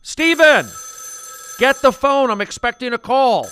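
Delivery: high, loud yell from far off; from another room. Acted. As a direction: call out from behind a door. Mom yelling from bathroom.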